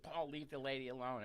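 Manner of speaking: ordinary delivery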